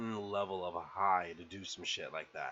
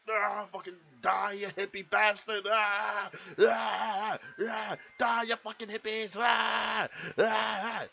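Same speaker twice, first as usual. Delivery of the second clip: high imitated rage screams; mock battle yell; 9 semitones higher, 4 dB louder than usual. Rick torching a hippie, high hammed-up war cries. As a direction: roar through it in a hammed-up fury.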